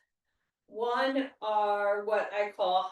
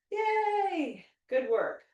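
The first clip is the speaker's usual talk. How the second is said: cheerful yay; 7 semitones higher, much slower than usual. Real, not acted. Cheering at end of set.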